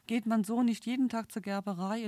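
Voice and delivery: plain reading voice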